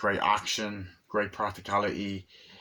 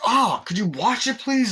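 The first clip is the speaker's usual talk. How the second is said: high, loud hammed-up yelp; mock pained complaint; 13 semitones higher, 7 dB louder than usual. Patient getting an injection in his ass, mock pained yell. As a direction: protest loudly as if jabbed.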